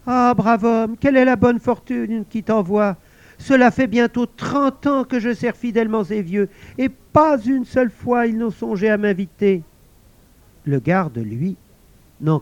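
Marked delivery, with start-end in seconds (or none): 0.1-9.6 high, pleased voice; a lonely spirit delighted at last; invisible servant exclaiming. Acted. Servant thanking the guard, high excited voice. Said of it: "La voix invisible s'exclama".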